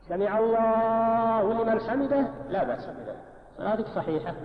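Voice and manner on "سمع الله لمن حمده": الله held long in سمع الله لمن حمده; slow, stretched الله; long vowel after lam. Shown as a demonstration. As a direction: sustain "allaaaahu" on a level tone, then finish briefly. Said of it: "لو مددت".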